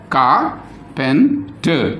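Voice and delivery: "Ka, pen, ter" as three separate beats; syllable by syllable. Shown as a demonstration. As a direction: count out "Ka, pen, ter" with a gap after each. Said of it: "So three beats are there".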